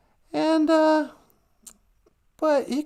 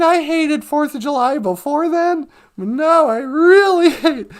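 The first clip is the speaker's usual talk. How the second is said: normal talking voice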